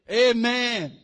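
booming, drawn-out pulpit shout; loud amen. Real, not acted. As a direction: call it out to the whole congregation. Preacher calls out to congregation.

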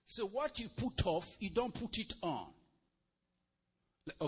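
normal talking voice

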